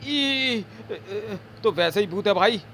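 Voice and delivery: high squeal of fear; frightened squeal. Acted. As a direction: yelp in fright then babble. Servant sees ghost. (डर से चीख़ते हुए)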